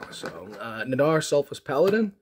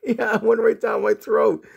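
high, slow retort through laughter; louder mock-indignant protest breaking into laughs; laughing indignation; 9 semitones higher, 5 dB louder, slower than usual. Patient to dentist about the tooth, laughing indignation.

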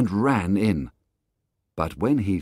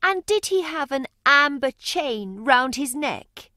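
much higher, urgent questioning woman's voice; anxious mother. Acted. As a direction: press him with questions, hoping.

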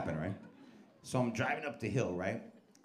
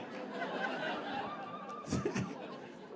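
high cackle; 7 dB quieter than usual. Acted. Cackle after Muppet bit.

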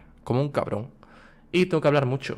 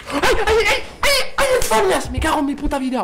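high, loud frantic ay ay ay; frantic wails; 4 dB louder than usual. Real, not acted. Gamer losing an epic play, frantic wailing. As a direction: wail in real panic. Near-miss outburst.

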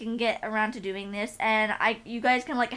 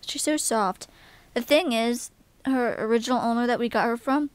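ordinary talk

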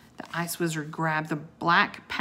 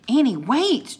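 raised, urgent call; 7 semitones higher, 6 dB louder than usual. A narrator's reading. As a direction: call after someone running ahead, higher and louder. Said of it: "Jack called".

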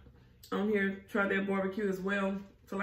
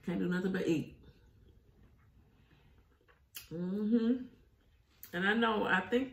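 biting while talking; speaking between bites; food at mouth. Real, not acted. Seen 3: picks at rib tips, then bites one near the end.

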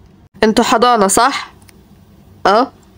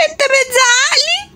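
put-on high, slow whimpering kid voice; high, slow tearful kid; hurt child; 11 semitones higher than usual. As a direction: ask like a wounded child.

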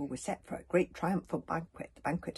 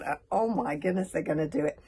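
plain reading voice

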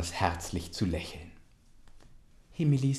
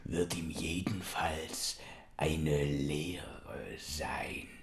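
slow whisper; 3 semitones lower, 5 dB quieter, much slower than usual. Acted. Uncle whispers.

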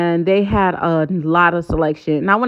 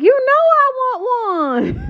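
high, slow, eager squeal; excited; slower than usual. Retold or imitated. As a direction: gleefully sing out the yes. Eager reply to cookie offer.

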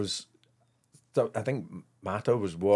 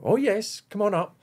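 much higher, bright welcoming doorkeeper; receptionist voice; 12 semitones higher, 3 dB louder than usual. Retold or imitated. Imitating Kathy at the door waving them up, much higher. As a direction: lift the voice right up like a cheery receptionist.

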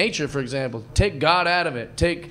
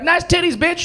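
higher, loud catcall; acted catcaller's shout; mock catcall; 12 semitones higher, 4 dB louder than usual. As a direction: call it out brashly like a street heckler. Acting out a catcaller.

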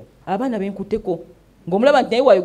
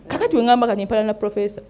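ordinary delivery

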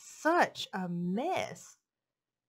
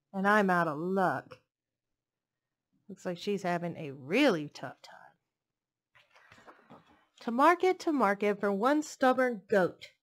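put-on lilting read-aloud voice for little kids; storybook reading; 5 dB louder than usual. Reading a picture book to young children, lilting storytelling. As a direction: read it like bedtime story time.

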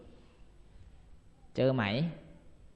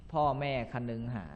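usual speaking voice

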